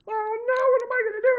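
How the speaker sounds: very high, loud wailing lament; distraught crying. Acted. Young husband who lost his wife, high loud wail. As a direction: wail in despair, voice way up. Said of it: "he's crying".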